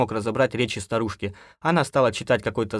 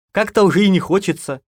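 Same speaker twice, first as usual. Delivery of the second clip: louder, higher wry aside; ironic; 9 semitones higher, 8 dB louder than usual. A narrator's reading. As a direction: slip it in with irony. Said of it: "с иронией вставил я". (с иронией, громче обычного)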